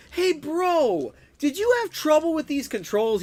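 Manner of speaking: high-pitched retold outburst; exclaimed question. Streamer asks a friend about bad controls, high exclamation. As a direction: call it out high, half mock-desperate.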